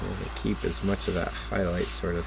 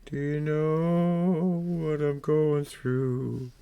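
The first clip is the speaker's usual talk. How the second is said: mock lament; 5 semitones higher, much slower than usual.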